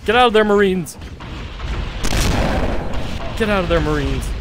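calling out. Real, not acted. Calling to Marines.

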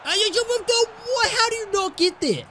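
frustrated outburst. Real, not acted. Player frustrated at teammate AI.

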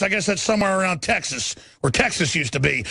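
ordinary talk, nothing marked

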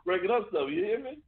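put-on old man voice. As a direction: scold the kid like a stern grandfather. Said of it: "that old man voice".

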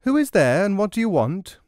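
high, loud call from upstairs. Acted. Merchant's wife calling out to a stranger at the door, loud. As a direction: call down to the street. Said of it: "The merchant's wife called out".

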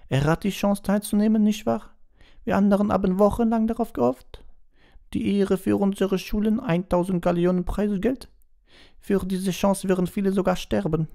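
put-on very high, loud indignant girl voice; Fleur's outburst. A narrator's reading. Fleur protests to the teachers, high and indignant. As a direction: burst out high and offended.

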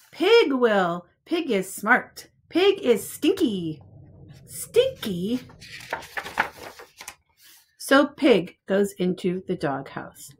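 lively picture-book read-aloud; reading to children. Acted. Teacher reading a picture book to preschoolers, lively storytime voice. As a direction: read it out like storytime for little ones.

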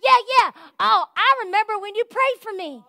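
lightly voiced excited stranger; stranger at post office. Stranger recognizing a church member, mildly excited. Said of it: "They'll be like".

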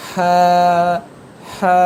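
হা held long from the middle of the throat; drawn-out pharyngeal হা; mid-throat letter. Shown as a demonstration. Teacher demonstrating the throat letter, long mid-throat হা. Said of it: "গলার মাঝখান থেকে হা হরফটি".

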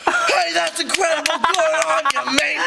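high, cheery Australian; Australian shark host. Pretend. Shark leading the meeting, cheery Australian voice. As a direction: cheer them on like a hearty Aussie host.

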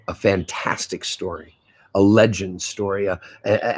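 ordinary delivery

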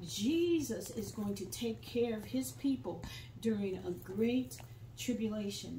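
ordinary delivery

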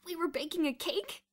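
high, loud croak. A narrator's reading. Kathy explaining the cake to the boys, croaking. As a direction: croak it out with voice almost gone. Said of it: "Kathy croaked".

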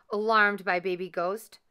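plain reading voice

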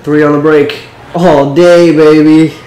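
loud hype after scoring; hyped. Real, not acted. Gamer hyping his own bucket, loud and excited. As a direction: cheer yourself on, loud.

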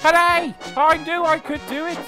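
very high, triumphant cheer; jubilant. A narrator's reading. James reaches the top of the hill, jubilant.

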